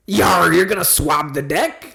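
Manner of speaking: slow pirate growl. Acted. Testing what country a pirate comes from, pirate accent. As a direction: order the crew about like a salty pirate. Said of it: "I'm gonna do this for you".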